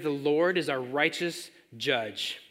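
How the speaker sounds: slowed, deliberate statement; slow emphasis. Acted. Preacher slowly drives home the point to the congregation. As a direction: lay each word down slowly. Stressing key phrase.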